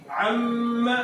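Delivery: "عم" with long nasal hum on m; ghunnah. Shown as a demonstration. Teacher giving an example of shadda, ghunnah. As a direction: hold the doubled m of "عم", humming. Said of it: "گننا کیوں کریں گے".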